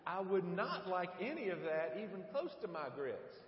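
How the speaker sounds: emphatic mock-indignant refusal; overstrong refusal. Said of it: "as if I reacted overstrongly".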